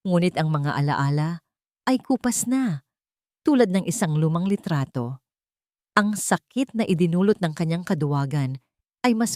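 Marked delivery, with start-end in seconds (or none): none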